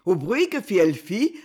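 ordinary delivery

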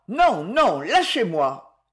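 put-on a boy fighting to break free; struggling protest. A narrator's reading. Boy struggling against cousin's grip. (cri du garçon qui se débat, plus haut et plus fort)

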